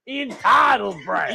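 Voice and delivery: put-on slow, high, furious old man yell; angry boomer yell. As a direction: shout it like a ranting boomer. Boomer yelling at youth.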